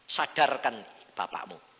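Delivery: preacher voicing God's command loudly. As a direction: deliver it as God giving an order. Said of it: "Tuhan berkata".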